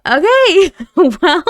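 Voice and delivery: high, loud, laughing disbelief; real startled reaction. Real, not acted. Player reacting to a surprise pregnancy, startled and laughing. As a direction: burst out flustered with a laugh.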